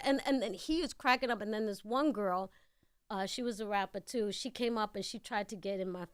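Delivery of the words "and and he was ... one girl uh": normal talking voice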